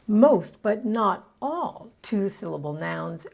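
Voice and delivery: ordinary delivery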